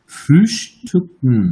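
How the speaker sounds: Frühstücken drawn out, ü and final -ken clearly spoken; slow German demo. Shown as a demonstration. Teacher showing the correct German sound to students, slow. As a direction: model Frühstücken very slowly. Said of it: "এটা উচ্চারণ করছে না".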